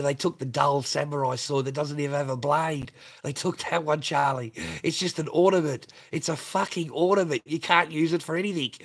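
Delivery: high, loud outraged retelling of a friend; loud mock outrage, high pitched; indignant loud quote. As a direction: blurt it out as the furious mate.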